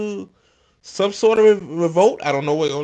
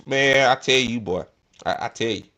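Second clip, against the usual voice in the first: normal talking voice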